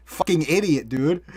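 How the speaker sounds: usual speaking voice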